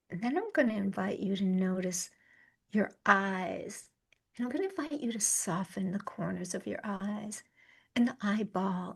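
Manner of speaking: soft, unhurried meditation voice with long pauses; guided meditation. Acted. Yoga teacher guiding a listener through the senses, calm meditation voice. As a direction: guide them gently inward, leaving space between phrases.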